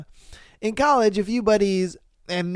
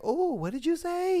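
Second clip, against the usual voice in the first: curious women; 11 semitones higher, 4 dB quieter than usual. Retold or imitated. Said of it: "they're gonna be like".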